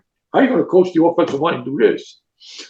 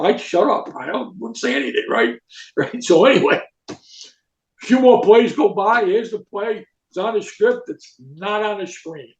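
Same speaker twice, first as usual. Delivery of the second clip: ordinary talk, nothing marked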